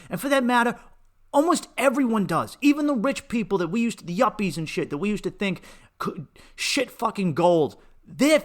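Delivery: loud, high, relentless yelling; furious rant. Retold or imitated. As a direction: keep shouting the whole tirade, no letting up. Ranting at mother about shame.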